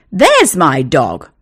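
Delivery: loud, slow joyful yell. A narrator's reading. Owner spotting his dog with the outlaws, yelling. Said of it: "yelled the terrier's owner".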